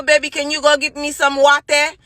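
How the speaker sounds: normal talking voice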